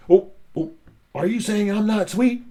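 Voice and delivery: loud, high, offended outburst; indignant high-pitched yell; upset yelling tomato. Acted. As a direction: shout it like a hurt, insulted tomato. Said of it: "'cause he's yelling really loud".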